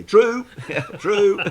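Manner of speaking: high, loud, laughing "true" call; instant, gleeful shout of judgement; emphatic verdict. Real, not acted. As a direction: blurt it out loudly, high-pitched and amused. Blurts verdict in guessing game.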